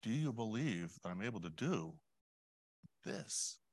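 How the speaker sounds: soft, gentle, unhurried question; hushed and caring, pausing before the last word; tender. Acted. Jesus speaking to anyone with a troubling issue, tenderly. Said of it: "to hear those tender words".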